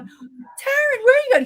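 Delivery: high loud alarmed call; calling after her. Retold or imitated. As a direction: shout after her as she runs. Friends calling after fleeing speaker.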